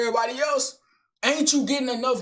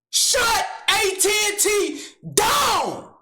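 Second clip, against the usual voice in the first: slow, higher yelled threat; furious vow; 7 semitones higher, slower than usual. Acted. Man swearing to take down AT&T, furious shout. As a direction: roar it like a war cry. Vowing revenge on AT&T.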